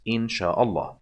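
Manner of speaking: ordinary talk, nothing marked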